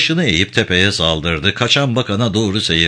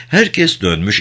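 ordinary delivery

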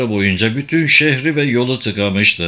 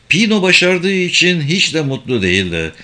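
usual speaking voice

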